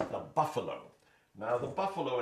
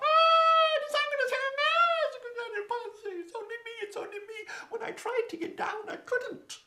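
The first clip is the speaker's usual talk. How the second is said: very high little voice with a sob; a scared girl on the rock near tears; frightened girl; 8 dB louder than usual. Acted.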